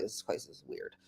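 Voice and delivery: hushed whisper, almost no voice. Retold or imitated. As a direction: breathe it like a spooky secret. Godmom's retold remark, whispered.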